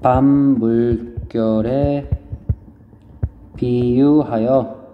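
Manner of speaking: very slow, syllables stretched out; writing-along dictation. Real, not acted. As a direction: draw out each phrase while writing it down. Dictates while writing the answer. (받아쓰듯 음절을 길게 늘여 천천히)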